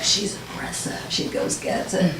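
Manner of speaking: a lightly voiced, hushed, breathy mock quote; a hint of muttering colleagues; mocking the guys. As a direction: whisper it like their gossip about her. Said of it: "some guys like".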